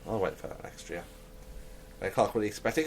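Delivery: ordinary delivery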